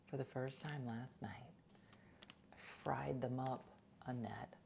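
hushed whisper. Real, not acted. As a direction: drop to a whisper. Whispered aside about frying.

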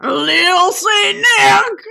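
drawn-out dramatic wail. Acted. Cohost reads the song lyrics as a wailing shouted refrain. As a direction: stretch it into a wailing yell.

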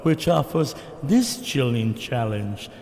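slow, spooky tour narration; ride announcer voice. Pretend. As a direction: intone it like the ride's ghost host. Reciting Haunted Mansion ride intro.

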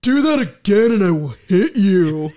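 loud mock menace; mean threat. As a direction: imitate a mean, threatening guy. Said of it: "looks at him so mean".

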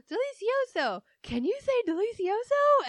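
high, loud bright Dora teaching voice; mock Dora. Dora on screen, teaching her parents at breakfast: high, loud mock voice. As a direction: sing it out high like a kid host. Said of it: "she goes".

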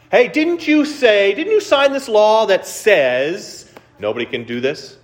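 butter up the king, pitched high; scheming officials. Acted. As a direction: officials springing the trap on the king, wheedling high.

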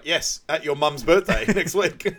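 high, loud, laughing through the line; laughing quote. Retold or imitated. Retelling Carly's reply while laughing out loud. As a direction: crack up while mimicking her. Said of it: "she's like".

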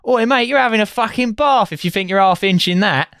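high, hammed-up Cockney rhyming-slang voice; Cockney soldier. British soldier teasing an American over a Luger, mock Cockney. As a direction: rib the American like a cheeky Cockney squaddie. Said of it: "I remember this line".

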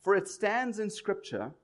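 slow, solemn Bible reading; scripture reading. Acted. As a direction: read the verses slowly and solemnly. Reads the Bible passage aloud.

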